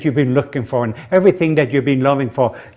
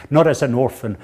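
ordinary talk, nothing marked

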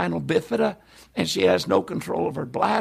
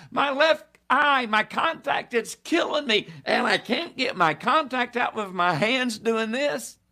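tearful complaint; 7 semitones higher than usual. Retold or imitated. Crying man begs about his contact.

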